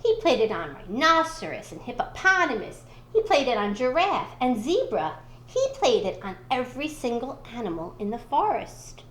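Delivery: ordinary delivery, nothing marked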